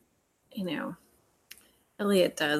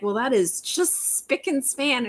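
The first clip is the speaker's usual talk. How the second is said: mock Southern accent; 5 semitones higher than usual. Hardison, posing as a country fan, in a mock Southern accent. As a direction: sell the fake cowboy aphorism with a Southern accent. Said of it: "takes it to a whole nother level".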